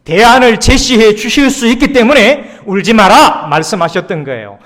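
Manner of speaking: loud, high preaching voice; full-force preaching. Acted. Pastor preaching to the congregation, loud and fervent.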